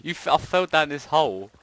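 high, loud laughing outcry; excited yell. Real, not acted. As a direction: yelp as you tumble in. Falls down hole in game.

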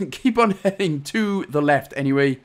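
ordinary talk

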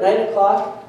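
ordinary talk, nothing marked

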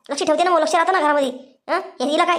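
usual speaking voice

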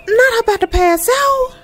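high exclaim. Real, not acted. Reacting to game character fainting.

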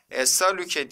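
"Esalüke" with A in the second syllable; change the second vowel to A in "Esalüke"; wrong reading. Shown as a demonstration. Teacher quizzes students with a wrong reading. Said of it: "diye okuduk mu?".

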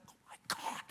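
hushed, voiceless amazement; acted breath of disbelief; awed whisper. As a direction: whisper it as if stunned by a revelation. Retold awed reaction to the advice.

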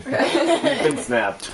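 laughing. Real, not acted. Laughing verdict.

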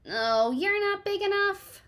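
high, slow dismissive bug voice; grouchy ladybug. Acted. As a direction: brush them off like a cranky bug. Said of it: "said the grouchy ladybug".

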